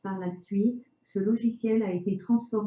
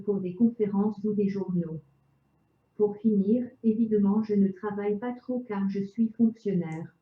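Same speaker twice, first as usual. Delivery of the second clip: chipper text to speech voice; synthetic voice. Real, not acted. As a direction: speak like a computer speech synthesizer. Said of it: "avec une voix de synthèse".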